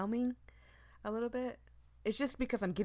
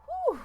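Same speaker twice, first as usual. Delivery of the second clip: startled whew; 9 dB louder than usual. Real, not acted. Startled reader exhales.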